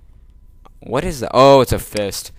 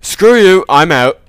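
much louder, higher shout of disgust; angry shout; 7 semitones higher, 12 dB louder than usual. Real, not acted. Creeped out by the level, yells that he's leaving, loud. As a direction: bail out with a loud yell.

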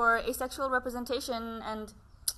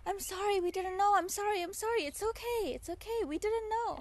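higher, softer soothing apology; a hint of a tender father comforting his baby; soothing dad; 9 semitones higher, 5 dB quieter than usual. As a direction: soothe a crying child gently. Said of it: "soothes her and says".

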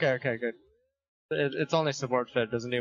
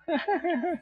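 squeaky high laugh; high giggle. Real, not acted. Gamer giggling about playing Shyvana. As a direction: giggle at your own joke.

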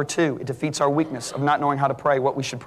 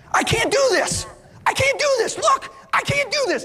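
mock frightened shouting; panicked protest. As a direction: blurt it out in alarm. Frightened man retold, healing.

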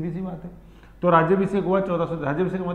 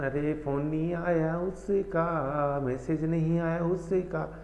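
mock distracted student; 6 dB quieter, much slower than usual. Mocking a distracted student's thoughts.

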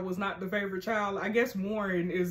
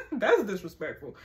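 laughing; 5 dB louder than usual. Real, not acted. Laughs at Brady's sex comment.

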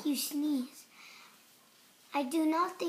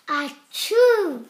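louder acted sneeze cutting the words; sneeze mid-sentence; 13 dB louder than usual. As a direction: sneeze right through the line. Sneeze breaks into speech.

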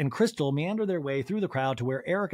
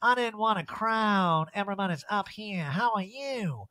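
Erica; 5 semitones higher than usual. Retold or imitated. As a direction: draw every phrase out in a higher put-on voice. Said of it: "And Erica shuffles over".

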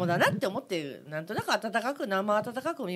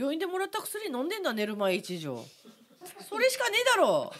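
Ibaraki dialect; 6 semitones higher than usual. Retold or imitated. Answering the pollen question as a mock Ibaraki local, Ibaraki accent. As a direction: put on a rough, joking Ibaraki country accent. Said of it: "何弁？".

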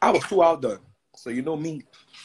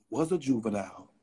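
ordinary talk, nothing marked

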